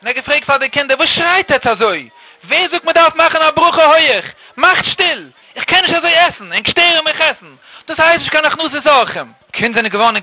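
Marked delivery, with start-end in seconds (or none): 1.0-9.3 higher-pitched, indignant telling-off; angry scolding. Acted. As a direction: scold them like an outraged old guest. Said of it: "ganz erschrocken".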